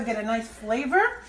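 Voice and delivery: normal talking voice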